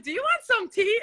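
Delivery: high laughing offer of tea; laughing quote. Retold or imitated. Host retelling offering tea, laughing. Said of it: "I was like".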